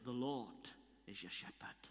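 a lightly voiced Welsh prophecy, dying away; Welsh accent. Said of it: "This broad Welsh accent".

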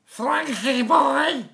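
high, stretched-out Scouse going weird; Scouse accent. Pretend. Overdoing the greeting to the lecturer, high-pitched Scouse. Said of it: "how to do a Scouse accent".

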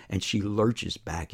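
ordinary delivery, nothing marked

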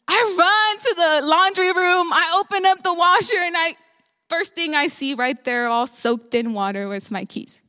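normal talking voice

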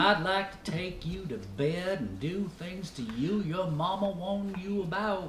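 soft Southern come-on; Southern accent. Acted.

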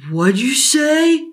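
put-on menacing drunk challenge; angry father. Father hauling Billy by the collar, loud and slow.

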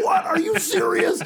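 can't believe the price, very high; shocked disbelief. Retold or imitated. Dropping the shovel at Gary's news, very high shock. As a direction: voice shooting way up in shock. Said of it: "And I was like".

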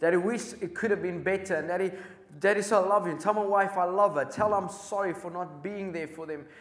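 put-on a dying soldier cries his last message to his girls; desperate, breaking plea; crying out. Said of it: "this soldier is crying out".

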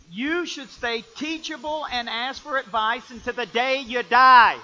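loud, high, emphatic sermon; full-force preaching. Acted. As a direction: drive it home to the congregation.

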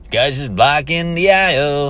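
mock singsong complaint, pitched high; passive-aggressive singsong. Shopper griping about the blocked aisle, passive-aggressive singsong. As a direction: imitate a man singing his grievance. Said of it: "passive-aggressive singing his complaint".

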